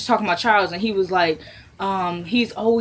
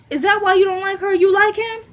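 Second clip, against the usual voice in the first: high, prying mock woman's voice; teasing woman; 11 semitones higher than usual. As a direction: voice her poking at him. Voicing woman teasing Landon.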